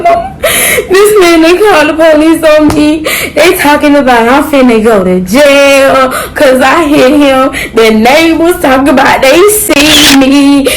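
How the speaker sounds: loud, high, half laughing and half crying; blurting it all out through panic; panicked crying. Retold or imitated. Calling her mother, frantic and tearful.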